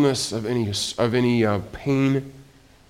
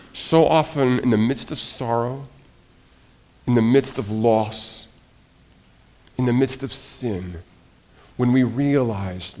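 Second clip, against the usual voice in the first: ordinary talk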